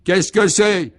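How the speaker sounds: high, loud, cross yell; howled question. A narrator's reading. Chambermaid answering a hotel guest's call, yelling. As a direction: yell it like a fed-up maid. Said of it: "hurle une femme de chambre".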